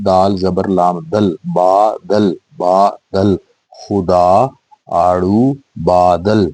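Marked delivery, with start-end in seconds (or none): 0.1-3.4 slow letter-by-letter دال زبر لام دل; teacher spelling دال زبر لام دل for pupils. Shown as a demonstration. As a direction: spell it out slowly for children. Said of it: "بے الف با".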